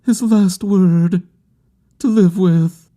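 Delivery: high, drawn-out, insistent plea; insistent grieving woman. A narrator's reading. Bereaved woman demanding his last word, high and insistent. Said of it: "she insisted".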